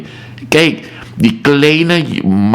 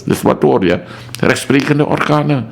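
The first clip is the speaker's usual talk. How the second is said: ordinary delivery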